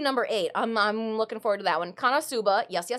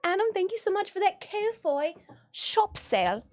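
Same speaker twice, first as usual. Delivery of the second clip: fancy lady voice; 7 semitones higher than usual. Retold or imitated. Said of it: "What a fancy lady".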